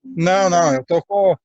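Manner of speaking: usual speaking voice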